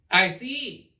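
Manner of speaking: high haughty queen voice; the queen complains grandly; imperious queen. A narrator's reading. Queen scolds gardeners, high haughty voice.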